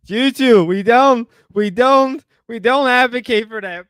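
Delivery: loud damage control for the stream; flustered scolding. Real, not acted. Scolding streamer's offensive clip.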